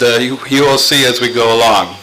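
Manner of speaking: ordinary delivery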